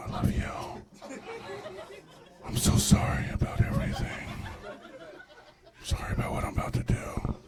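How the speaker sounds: breathy whisper to the portrait tattoo; mock tender whisper. Whispering apology to grandma's portrait before a private moment. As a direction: whisper it like a guilty confession.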